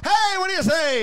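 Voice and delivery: high hollered greeting; re-enacted street shout; shouted back. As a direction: holler it high after a passing car. Shouts back at passing car.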